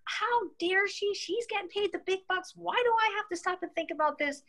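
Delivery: mock indignation. Retelling her fury at the manager's advice, high and indignant. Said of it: "I was so angry with her".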